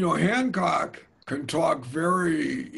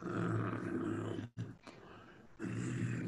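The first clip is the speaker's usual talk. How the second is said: low whispered appraising hum; 13 dB quieter than usual. Retold or imitated. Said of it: "and he's like".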